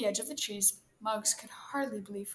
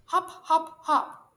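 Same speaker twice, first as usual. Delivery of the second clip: loud, high, bouncy chant; encouraging call; 7 semitones higher, 8 dB louder, slower than usual. A narrator's reading. Rabbit coaching turtle along the path, calling encouragement. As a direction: call out each hop to urge someone on. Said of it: "calling words of encouragement".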